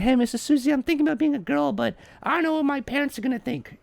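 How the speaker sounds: put-on high-pitched, soft little kid voice; small, high child's voice. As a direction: play a kid confiding in a teacher. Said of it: "if I am a little child".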